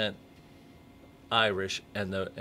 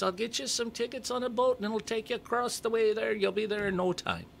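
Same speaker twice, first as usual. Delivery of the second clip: leprechaun voice; 13 semitones higher than usual. Acted. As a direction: pitch way up into a comic leprechaun. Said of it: "great leprechaun voice".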